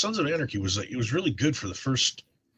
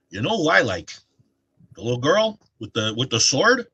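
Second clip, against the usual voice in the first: a lightly done Chicago dad voice, raised; Chicago accent; 4 semitones higher, 4 dB louder than usual. Retold or imitated. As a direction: impersonate dad's Chicago accent, a bit louder. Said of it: "He'll go".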